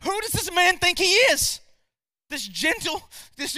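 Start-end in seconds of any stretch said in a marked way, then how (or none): 0.0-1.6 indignant quote. Retold or imitated. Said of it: "Jesus is like".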